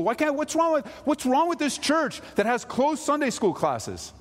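high, exasperated mock whine; hammed-up outrage about closed classes; frustrated parent. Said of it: "It makes you so frustrated".

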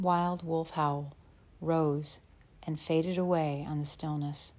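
ordinary delivery, nothing marked

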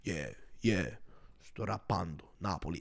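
deadpan, stern imitation of a rapper; stern mock rapper. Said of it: "a stern face".

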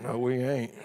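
low, soft grumbled refusal; grumbling husband. Retold or imitated. Husband refusing wife's remodel idea, low grumble. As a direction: mutter a husband's flat no. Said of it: "We can do that".

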